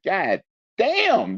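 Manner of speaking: high, drawn-out retold exclamation; stunned disbelief. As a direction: reenact the shock of hearing the count. Retold shock at gunshot count.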